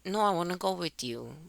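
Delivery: softly imitating a daughter's quiet plea; small child's reply.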